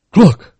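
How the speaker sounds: sharp loud click of the tongue; a hunter's sudden signal click; tongue cluck. A narrator's reading. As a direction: snap the tongue against the palate. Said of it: "making a peculiar noise with his tongue".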